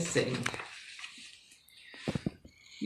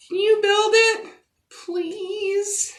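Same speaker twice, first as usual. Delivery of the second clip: put-on pitched way up, begging little girl; high pleading child; 13 semitones higher, slower than usual. Little girl begging the landlord for a playground, high and pleading. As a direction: plead like a small child, hopeful.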